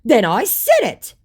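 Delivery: high, loud, slow mock indignation; indignant scammer. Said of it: "Forever indignant".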